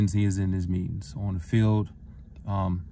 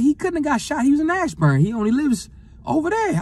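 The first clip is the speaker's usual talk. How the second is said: much higher, protesting denial; shocked disbelief; 4 dB louder than usual. Retold or imitated. Telling his mother the news can't be true, much higher and protesting. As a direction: refuse to believe it.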